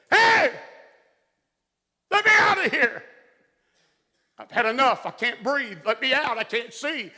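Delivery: high, loud desperate cries to be let out; panicked screaming. Retold or imitated. Trapped soldier begging to be let out, screaming high. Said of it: "he started screaming at the top of his lungs".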